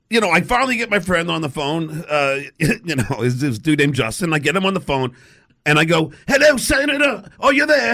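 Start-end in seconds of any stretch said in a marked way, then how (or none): 6.3-8.0 high, put-on English accent; fake English accent. Prank caller patches friend in as senator, fake English accent. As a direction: pitch it up into a hammy English accent. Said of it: "pretend I'm the English dude".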